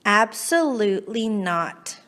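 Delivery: plain reading voice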